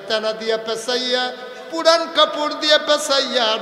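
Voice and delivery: building preaching. Acted. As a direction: climb with each repeat.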